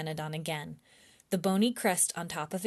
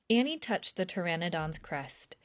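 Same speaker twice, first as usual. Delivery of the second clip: usual speaking voice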